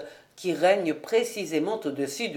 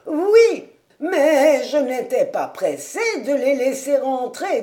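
put-on old servant pleading; 4 semitones higher, 6 dB louder than usual. Caleb pleads with his master, loud quavering old servant. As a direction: plead like a fussing old retainer.

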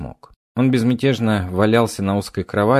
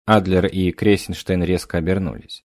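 plain reading voice

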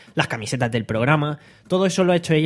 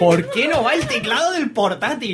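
exasperated; 8 semitones higher than usual. Real, not acted. Keyboard fails live on air.

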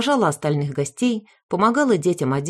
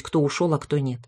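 ordinary delivery

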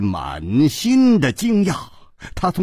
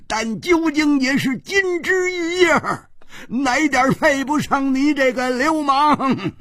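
wild roar; 12 semitones higher than usual. Acted. The red-eyed king rages at the man he thinks killed his daughter, crying out high. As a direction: howl it out, near breaking. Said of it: "他狂吼道".

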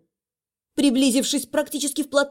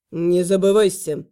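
menacing warning; 7 semitones lower than usual. Acted. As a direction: warn her with cold menace. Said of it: "сказал он таким голосом, что меня страх пробрал".

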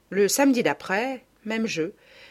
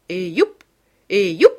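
loud festive yelps; calling out; 8 dB louder than usual. A narrator's reading. Mother whoops as she bursts into the dance, loud. Mother cries out while dancing.

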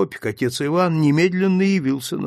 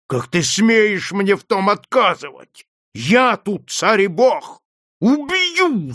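higher, louder rage climbing to a yell; through gritted teeth, raging; 7 semitones higher, 4 dB louder than usual. Acted. Rage through gritted teeth. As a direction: master threatens the priest, higher and louder with rage. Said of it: "заскрипел он зубами".

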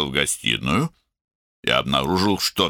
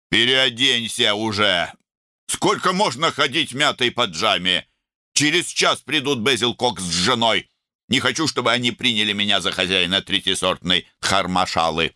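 raised, insistent nagging; irritated scolding; 6 semitones higher than usual. A narrator's reading. Older brother scolding younger before guests come, irritated. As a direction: scold at a high pitch, exasperated.

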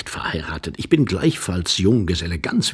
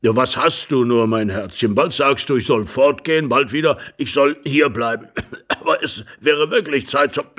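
put-on louder old man's voice; baffled old husband; 4 semitones higher, 4 dB louder than usual. Old husband hears stay and go, baffled. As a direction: scold in bewilderment as an old husband.

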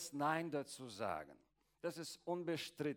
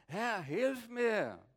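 slow, higher, pleading cry for help; pleading woman; 6 semitones higher, 5 dB louder, much slower than usual. Acted. Kneeling woman begging Jesus for help, slow and pleading. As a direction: beg him slowly, from your knees.

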